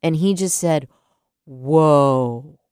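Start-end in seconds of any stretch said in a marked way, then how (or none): 1.4-2.6 a mild imitation of a big man's louder whoa. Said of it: "this big whoa out of this big man's deep voice".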